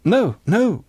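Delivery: high-pitched protest; high, urgent denial; crying out. A narrator's reading. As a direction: cry it out in protest. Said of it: "cried John".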